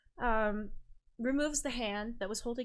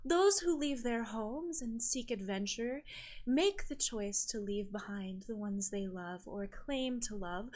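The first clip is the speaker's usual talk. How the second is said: stately, rhetorical priestess rebuke; lofty island sister; 2 semitones higher than usual. Acted. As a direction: lecture the sorceress with grand, measured phrasing. GM plays island sister's speech.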